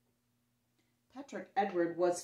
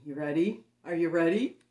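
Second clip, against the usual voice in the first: rising, louder teasing question; building anticipation; 7 dB louder than usual. Real, not acted. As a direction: build the suspense louder each time.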